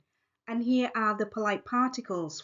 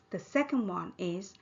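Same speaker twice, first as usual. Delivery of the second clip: ordinary talk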